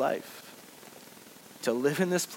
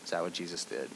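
ordinary delivery

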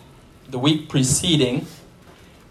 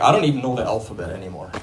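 fast, lower, rattled-off confusion; exasperated giving up; 3 semitones lower than usual. Retold or imitated. Preacher voicing a confused believer, fast and exasperated. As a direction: throw up your hands, talking fast. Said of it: "you're like".

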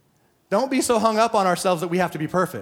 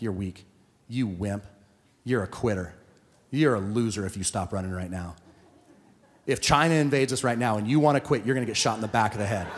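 self-insult; 6 semitones lower than usual. Retold or imitated. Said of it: "I'm like".

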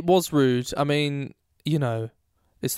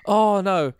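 usual speaking voice

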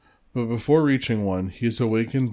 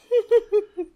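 high laugh; 4 dB louder than usual. Real, not acted. Reacting to fireworks mayhem.

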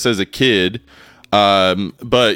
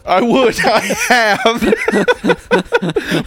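slow drawn-out laughing confession; laughing slow admission; 13 semitones higher, much slower than usual. Pretend. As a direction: laugh through it slowly. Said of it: "The guy is like".

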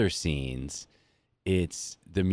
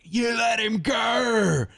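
high, slow shouted line in a bad Australian accent; 4 dB louder, much slower than usual. Mocking the actor in the film's last scene, shouted bad Australian accent. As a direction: yell it in a cringe-worthy fake Australian accent. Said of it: "the worst Australian accent I think I've ever heard".

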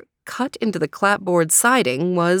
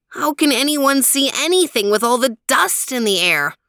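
much higher, whiny small dog; high complaining voice; 7 semitones higher, 3 dB louder than usual. Acted. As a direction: grumble up high about the dust. Theo complains.

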